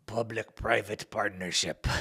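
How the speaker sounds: slow mock German accent. Quoting a World Economic Forum leader, mock German accent. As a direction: put on a rough German accent. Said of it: "In my best German accent".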